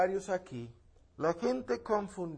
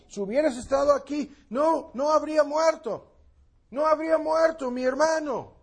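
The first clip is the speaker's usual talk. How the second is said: Marta's lament; 7 semitones higher, 5 dB louder than usual. Acted. Quoting Marta's lament to Jesus.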